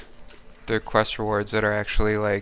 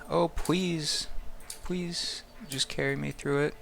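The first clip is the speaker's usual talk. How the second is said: higher-pitched mock pleading; playful begging; 5 semitones higher, 5 dB quieter than usual. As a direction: plead like you're begging a favour, not quite seriously. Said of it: "we do a little bit of begging".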